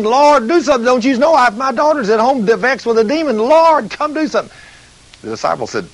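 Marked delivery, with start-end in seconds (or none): 0.0-4.5 begging woman. Retold or imitated. Mother pressing Jesus for her daughter, high urgent begging. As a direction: beg him urgently, voice climbing. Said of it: "she's begging".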